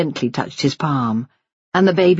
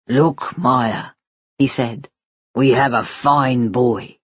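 lightly lowered voice of a doting father; tender father; 3 semitones lower, slower than usual. Acted. Father promising his baby son a future, tender. Husband shows baby to wife.

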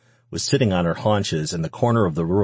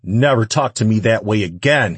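loud, raised-pitch warning; angry shout; 4 semitones higher, 6 dB louder than usual. A narrator's reading. Man about to strike the woman, angry shout. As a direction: shout it as a threat. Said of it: "he shouted".

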